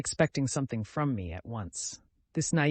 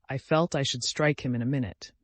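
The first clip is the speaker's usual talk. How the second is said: ordinary delivery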